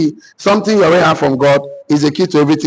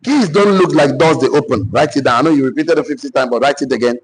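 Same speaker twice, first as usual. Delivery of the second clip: ordinary talk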